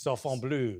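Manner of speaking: low imitation French accent. Host coaching the contestant's cat name, French accent. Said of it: "Was that a French accent you were giving me".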